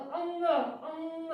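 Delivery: अंग, अंग said very slowly, the nasal held long at the back; drawn-out demo. Shown as a demonstration. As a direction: stretch out अंग so the nasal sound rings. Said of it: "हम बोलेंगे जैसे". (अंग को धीरे-धीरे खींचकर बोलना)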